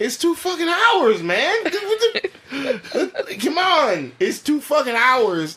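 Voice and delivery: high, laughing disbelief at the runtime; exasperated disbelief. Retold or imitated. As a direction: squeal it out in mock outrage at how long it is. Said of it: "I kept looking at my watch like".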